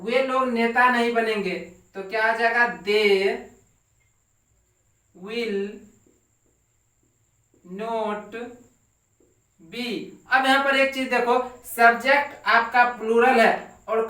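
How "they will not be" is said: each word set apart by long pauses; slow word-by-word dictation. Shown as a demonstration. Teacher dictating to the class, word by word, slowly. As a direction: dictate it one word at a time for students to copy. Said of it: "teacher dictating word by word".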